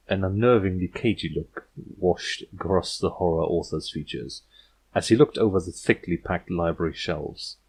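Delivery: ordinary delivery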